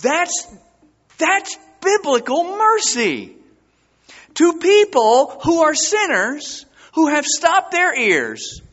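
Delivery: high, loud preacher's cry; impassioned sermon peak; preaching. Acted. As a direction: lift it up to the congregation. Preaching at full force.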